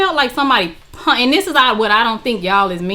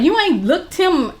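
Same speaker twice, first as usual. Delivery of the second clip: higher-pitched imitation of a scolding friend; incredulous scolding; 6 semitones higher than usual. Friend and mom chide her for not checking him out, incredulous. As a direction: mimic disbelief, pitch climbing as it goes on.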